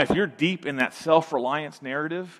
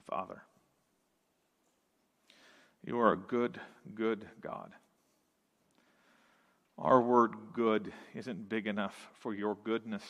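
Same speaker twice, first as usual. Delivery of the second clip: lower, softer prayer voice; 5 semitones lower, 8 dB quieter, faster than usual. Acted. Pastor leading the church in closing prayer: low, soft, earnest. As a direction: pray it quietly to the Father.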